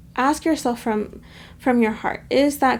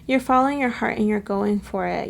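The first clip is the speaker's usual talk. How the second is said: normal talking voice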